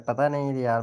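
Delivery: ordinary talk, nothing marked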